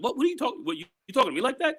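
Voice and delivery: lightly acted offended officer; mock indignant cop. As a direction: play the cop bristling at being questioned. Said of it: "I was like".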